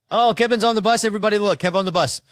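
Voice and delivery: high, loud excited call; hyped shout. Retold or imitated. Schoolkids on the bus cheering their friend, loud and high. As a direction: hype up your friend getting on the bus.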